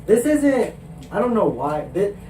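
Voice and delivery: ordinary talk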